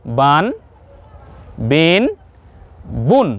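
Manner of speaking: "বান, বিন, বুন" one by one, each closed on n; pronunciation demo. Shown as a demonstration. Teacher recaps the three tanween sounds for viewers, pronunciation demo. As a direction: model "বান, বিন, বুন" as separate, held syllables. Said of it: "তাহলে আমরা পেলাম কি?".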